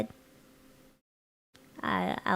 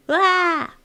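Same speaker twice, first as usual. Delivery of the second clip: loud high yelp; sudden yelp; 10 semitones higher, 10 dB louder than usual. Real, not acted. Streamer suddenly yelping at chat. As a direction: let out a sharp cry.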